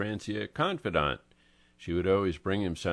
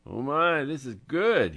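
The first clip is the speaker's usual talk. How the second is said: delighted; 8 semitones higher, 6 dB louder than usual. Acted. Angelo savoring the liver.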